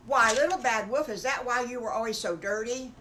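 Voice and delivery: loud, nosy little piggy; blurted question. Acted. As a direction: blurt it out before thinking.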